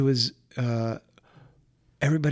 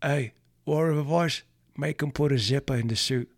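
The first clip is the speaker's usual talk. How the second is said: put-on British accent; 4 semitones higher, 5 dB louder than usual. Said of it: "And he did the accent".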